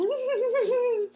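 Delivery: high mock giggle; put-on giggle of a group of girls; imitated girlish giggle. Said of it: "That's how girls giggle".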